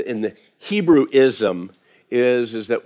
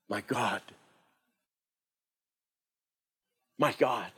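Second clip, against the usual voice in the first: much softer, a hushed heartfelt cry; 2 semitones lower, 14 dB quieter than usual. Retold or imitated. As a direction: let it fall almost to nothing, full of longing. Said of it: "heartfelt cry of our Savior".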